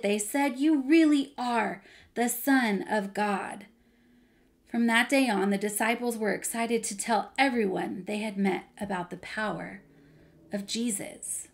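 animated storytime narration with big emphasis; lively Bible storyteller, playing up the drama; children's story reading. A narrator's reading. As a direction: read it expressively to young children.

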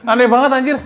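loud, high disbelief; exasperated exclamation. Real, not acted. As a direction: blurt it out, baffled by nonsense. Exasperated reaction to absurd story.

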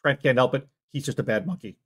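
ordinary talk, nothing marked

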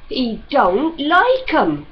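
flat, slow and stubborn. Acted. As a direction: dig in her heels, slowly. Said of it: "Prudy said stubbornly".

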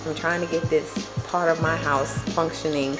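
ordinary talk

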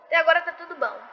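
usual speaking voice

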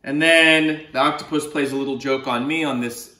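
usual speaking voice

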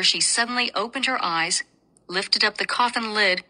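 usual speaking voice

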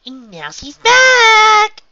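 very high, loud, stretched sing-song. A narrator's reading. Pinkie Pie announces Twilight's return, sing-song. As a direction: chime it out and hold the last word. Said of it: "said in a sing-song voice".

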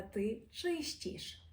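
«ти чистіш» very slowly with a soft щ; teacher shows the soft щ; soft Polish sounds. Shown as a demonstration. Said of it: "говоримо вже м'якіше".

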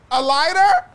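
a lighter? pitched high and loud in disbelief; incredulous. Real, not acted.

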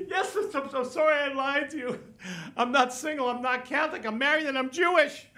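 high-pitched tearful confession; hammed-up weepy cabbie; mock bawling. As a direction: blubber it out like a guilty man. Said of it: "starts bawling his eyes out".

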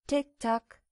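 "Tick-Tack" as two separate, falling beats; tired old clock. A narrator's reading. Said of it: "wie das Schlagen einer alten, müden Uhr". (wie eine alte, müde Uhr)

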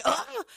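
high-pitched exasperated groan; frustrated groan, acting out being mad. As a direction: let out a hammed-up huff of fury. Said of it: "I was so mad".

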